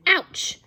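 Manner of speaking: high, loud sharp "Ouch"; pained yelp. Acted. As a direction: cry out in pain. Boy whacked on forehead.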